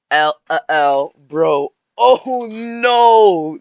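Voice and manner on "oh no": high, loud dismayed cry; surprised oh no. Real, not acted. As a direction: gasp it out in dismay. Reacting to height text.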